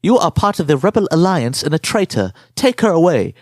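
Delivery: mock German accent on a stiff Darth Vader line; uptight German Vader. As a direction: imitate a prim German villain. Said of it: "sounds like some fucking uptight German dude".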